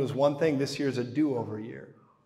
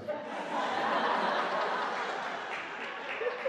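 quiet breathy laugh; 4 dB quieter than usual. Real, not acted. Laughing at own joke.

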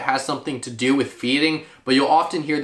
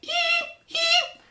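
very high one-note shrill eep; wood duck shriek. Shown as a demonstration. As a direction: squeak it out like a flying wood duck. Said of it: "just a one-note shrill shriek".

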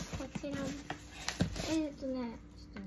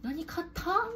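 surprised; 5 semitones higher, 5 dB louder, slower than usual. Retold or imitated. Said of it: "みたいな".

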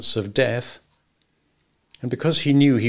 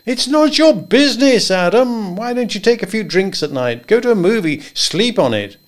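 higher, louder, bluff and jovial; higher voiced good old boy; hearty Henry; 10 semitones higher, 4 dB louder than usual. A narrator's reading. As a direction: boom friendly advice across the desk. Henry brushing off worry.

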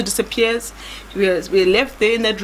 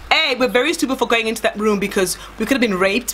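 ordinary talk

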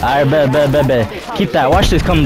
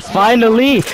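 excited; 7 semitones higher, slower than usual. Real, not acted. Gamer relieved at round win.

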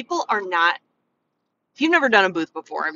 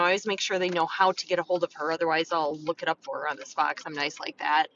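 ordinary talk

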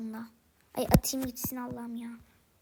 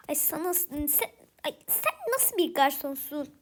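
normal talking voice

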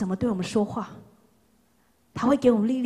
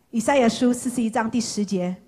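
ordinary talk

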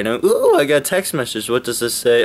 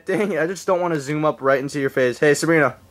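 ordinary delivery

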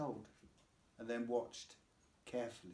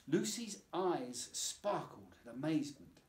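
ordinary delivery

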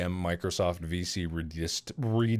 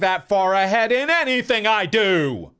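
much higher and louder hammed-up protest; mock shout; 10 semitones higher, 10 dB louder than usual. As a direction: yell it like a proud confession. Joking self-defence to friend.

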